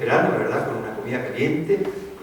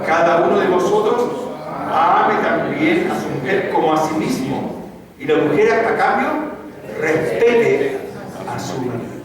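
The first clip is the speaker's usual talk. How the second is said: lectura en voz alta; 2 semitones higher, 5 dB louder, slower than usual. Acted. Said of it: "en voz alta lo vemos".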